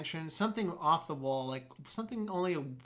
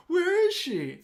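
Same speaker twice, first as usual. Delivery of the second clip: much higher, louder frantic question; frantic husband; 14 semitones higher, 8 dB louder, slower than usual. Retold or imitated. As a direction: demand it in a mock panic. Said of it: "he goes".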